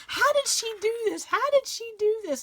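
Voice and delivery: high, amazed exclamation; high-pitched wonder at the handiwork. Retold or imitated. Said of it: "I was like".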